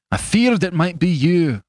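high, intense accusation; agitated, bitter outburst; bitter confrontation. Acted. Fetz bitterly confronts the doctor.

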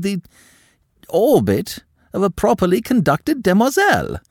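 high, loud, outraged aristocrat; indignant countess. A narrator's reading. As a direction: scold the girl with wounded pride. Madame lectures on propriety.

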